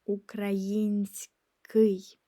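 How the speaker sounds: Український slow, aloud; slow voiced repeat of Український. Shown as a demonstration. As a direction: say Український slowly in full voice. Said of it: "which means Ukrainian".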